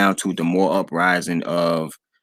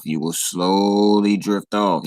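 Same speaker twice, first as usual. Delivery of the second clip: slow, soothing mock hypnotist; imitating a stage hypnotist lulling someone; much slower than usual. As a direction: drag it out like swinging a pocket watch. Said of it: "telling them to drift off".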